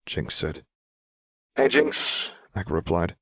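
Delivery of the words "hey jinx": put-on higher, lively boy's greeting; cheerful kid voice; young boy voice. Echo greets Jinx.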